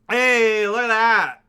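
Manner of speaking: high, loud burst of excitement; excited exclamation. Real, not acted. Streamer reacts to viewer's opened picture, loud excited exclamation. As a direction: exclaim at what just popped up, loudly.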